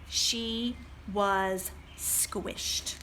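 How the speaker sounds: drawn-out, low and squeezed; slow dramatic pause. A narrator's reading. Librarian reading to children, slow and drawn out. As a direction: stretch it out, very slowly.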